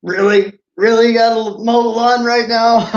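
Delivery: high, loud exasperated whine, half laughing; mock frustration. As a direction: play up the annoyance at the mower for laughs. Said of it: "I saw the frustration over the lawnmower".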